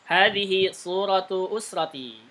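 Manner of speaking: Hadhihi suratu usrati with the long vowels held, slow; long and short vowels. Shown as a demonstration. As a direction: read slowly, long vowels full length. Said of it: "Panjang pendeknya benar kan".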